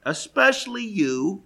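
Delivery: single them out with a sneer; Paul singling out the boastful church, scornful; pointed jab. Retold or imitated. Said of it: "he's saying".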